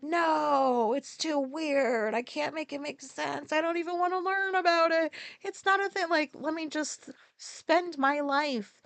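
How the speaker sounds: mocking people who refuse to learn, whiny; mock closed-minded. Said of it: "they're just like".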